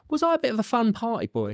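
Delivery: mock innocent question.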